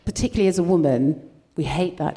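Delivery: ordinary talk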